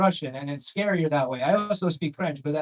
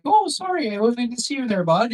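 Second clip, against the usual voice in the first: high, sweet apologetic voice; mock the too-nice local driver; simpering sorry pitched way up; overly polite nice guy; 7 semitones higher, 4 dB louder than usual. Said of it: "I'm not gonna be like".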